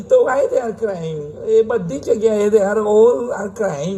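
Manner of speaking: high, slow mock foreigner asking why; hammed-up foreigner English; mock broken English. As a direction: drawl the silly question in broken English. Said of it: "વેવલો સવાલ પૂછેલો".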